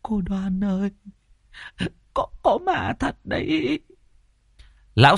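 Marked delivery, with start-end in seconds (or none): 0.0-3.8 high, soft tearful wail of the wife; stammering, near-crying plea; whimpering. A narrator's reading. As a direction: whimper in fright, pitched way up. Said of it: "thì mếu máo".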